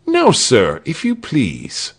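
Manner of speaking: high, courteous executioner; polite. A narrator's reading. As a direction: politely usher a victim forward. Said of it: "said the executioner politely".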